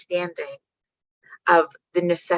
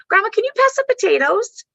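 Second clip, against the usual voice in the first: put-on high, sweet child voice; little girl voice; 6 dB louder than usual. Mom imitating her daughter asking grandma politely, high child voice. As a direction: chirp it like a polite toddler.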